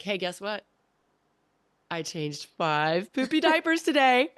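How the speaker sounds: hammed-up weary mom, announcing the diaper count; tired mom telling her husband about diapers, mock weary; mock bored mom.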